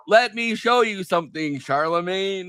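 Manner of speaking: Hillary Clinton. Retold or imitated. Imagined candidate answering a radio host's challenge, imitated high and slow. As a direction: draw it out high, like a politician taking up a dare. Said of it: "Hillary Clinton should have, should have been like".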